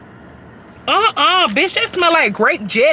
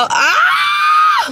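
shout; 3 dB louder than usual. Real, not acted. Tasting alcoholic strawberries, cries out.

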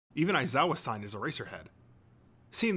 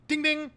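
high-pitched, loud voiced buzzer ding; 6 dB louder than usual. A narrator's reading. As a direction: chime a sudden high ding-ding. Narrator imitates the quiz buzzer.

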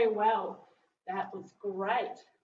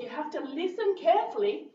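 ordinary talk, nothing marked

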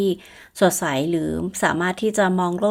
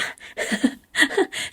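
breathy laugh; 9 semitones higher than usual. Real, not acted. Laughing at her odd hats.

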